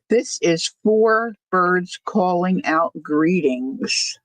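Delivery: ordinary delivery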